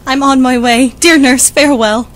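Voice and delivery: loud, bright parting cry to her nurse; joyful farewell. Acted. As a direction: call it out as she runs off. Juliet rushes off joyfully.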